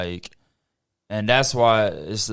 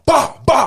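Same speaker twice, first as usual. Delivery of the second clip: high, loud smack noises; mock switch-smack noises; imitated smacks; 12 semitones higher, 5 dB louder than usual. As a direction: whip the sound out like lashes.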